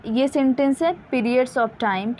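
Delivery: ordinary talk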